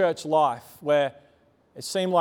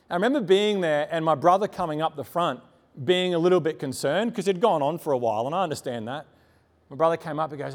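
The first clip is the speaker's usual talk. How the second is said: ordinary talk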